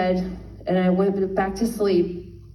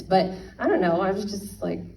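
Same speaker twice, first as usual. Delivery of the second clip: normal talking voice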